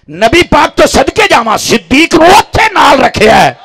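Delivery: high, loud shouted preaching; fiery sermon climax. Acted. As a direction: drive the crowd up at full force.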